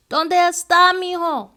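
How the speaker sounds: high, loud call from upstairs; grandma calling her grandson. A narrator's reading. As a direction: call the boy from another floor.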